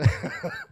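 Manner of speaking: sudden squeaky laugh; high laugh. Real, not acted. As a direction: burst into a high giggle. Laughing at drive-through memory.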